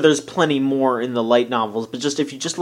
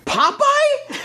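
high-pitched disbelieving question; stunned, squeaky-high disbelief; incredulous. Real, not acted. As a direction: blurt it out, voice shooting way up. Incredulous at co-host's Popeye answer.

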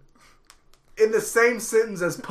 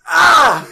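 sudden high, loud yell; imitated full-volume yell; 10 semitones higher, 12 dB louder than usual. Retelling his friend's yell in the car, sudden loud scream. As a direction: burst out with a startling scream. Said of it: "heard me yell at, like, full volume".

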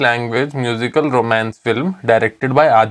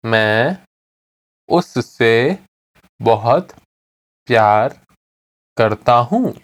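slow, pausing after every word; slowly; 2 semitones lower, faster than usual. Shown as a demonstration. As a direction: dictate it word by word to a learner. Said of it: "I'll say it again slowly".

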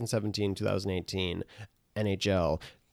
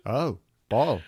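badly faked British accent; mock British lilt. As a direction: coo it as a dopey Liverpool drummer. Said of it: "so I could be [name]".

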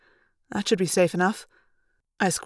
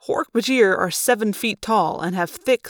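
plain reading voice

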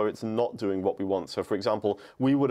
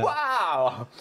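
mock excited wow; 8 semitones higher, 3 dB louder than usual. Shown as a demonstration. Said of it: "with your voice".

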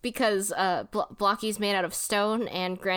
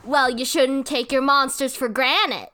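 hammed-up cartoon sidekick, louder; Escargoon; 2 semitones higher, 5 dB louder than usual. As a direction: deliver the pun as a mock-chiding henchman. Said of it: "Escargoon says".

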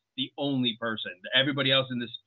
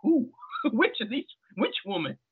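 high-pitched imitated bewilderment; mock-baffled string of questions; puzzled questioning; 11 semitones higher than usual. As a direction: voice her confusion, much higher, with a laugh breaking in. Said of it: "maybe she's trying to figure out".

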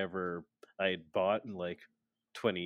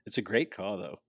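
normal talking voice

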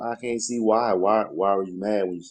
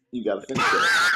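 mock shout. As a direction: shout it in a put-on demanding voice. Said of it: "maybe the tone in which I said it".

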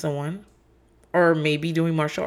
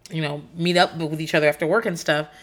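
ordinary talk

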